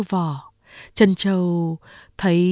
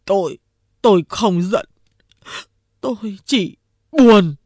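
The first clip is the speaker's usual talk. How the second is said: weepy big boss; sniffling, sad; 4 dB louder than usual. A narrator's reading. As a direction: say it through sniffles. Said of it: "Đại Hồ khóc sụt sịt".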